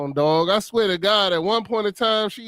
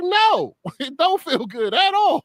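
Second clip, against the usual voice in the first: pitched-up mock indignant refusal; retold protest; 9 semitones higher, slower than usual. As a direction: protest high, as if pleading your case. Retells protesting the painful massage.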